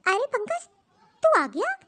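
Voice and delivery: oh, you're back already; surprised greeting. Acted.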